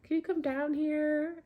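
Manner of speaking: high-pitched, sing-out call to someone upstairs. Retold or imitated. Driver to neighbour upstairs, calling up. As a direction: call up to a window, voice lifted. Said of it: "call up to them".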